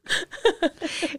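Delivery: high burst of laughter; nervous laugh. Real, not acted. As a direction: break into a giggle, can't help it. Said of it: "Elle rit tout le long". (éclat de rire aigu)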